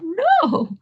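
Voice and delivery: high, emphatic declaration; acting a woman summoning defiant pride; proud resolve. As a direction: declare it to yourself with rising conviction. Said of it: "with tremendous pride".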